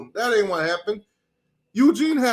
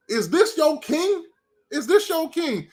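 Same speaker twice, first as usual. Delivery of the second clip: mocking question; 8 semitones higher than usual. Real, not acted. Mocking the rival quarterback.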